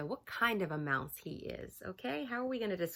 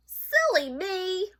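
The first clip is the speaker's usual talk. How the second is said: mock self-reproach; 12 semitones higher, 9 dB louder, slower than usual. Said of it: "muttered Mouse".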